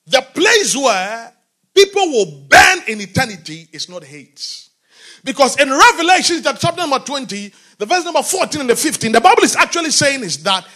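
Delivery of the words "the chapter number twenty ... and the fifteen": normal talking voice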